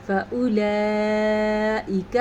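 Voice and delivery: فاولئک recited slowly, kaf kept short; slow recitation. Shown as a demonstration. Teacher reciting فاولئک for students. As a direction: chant فاولئک slowly without stretching the kaf. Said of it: "کاف کو لمبا نہیں کرنا".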